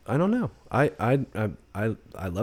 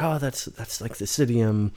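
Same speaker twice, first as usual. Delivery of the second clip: slow idiom; 3 semitones higher, 6 dB louder, slower than usual. Retold or imitated. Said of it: "she's like".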